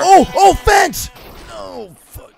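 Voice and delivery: sudden high yelp at the fence; alarmed shout. Real, not acted. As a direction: yell out as you crash. Crash while riding scooter.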